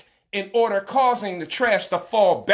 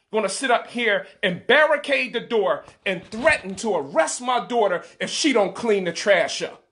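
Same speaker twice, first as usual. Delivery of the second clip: ordinary talk, nothing marked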